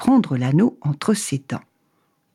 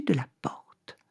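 soft whispered ending; 9 dB quieter, slower than usual. A narrator's reading. Narrator inside the thief's house, whispering. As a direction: let it fade to a whisper.

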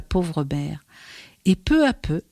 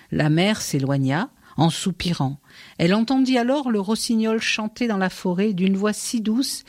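ordinary delivery

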